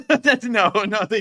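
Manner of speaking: laughing, high and loud. Real, not acted. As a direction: laugh through the denial. Laughing at the nuke question.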